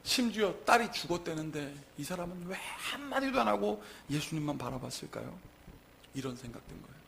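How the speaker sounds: ordinary talk, nothing marked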